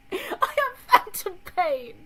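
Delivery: put-on high, loud, slow British-accented wail; overdone British accent, high and drawn out; British accent scream. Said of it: "scream it with the accent".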